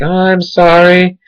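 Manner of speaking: high, slow mock lady apology; apologetic lady. Dog owner apologizing to the runner, high slow mock voice. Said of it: "she's like".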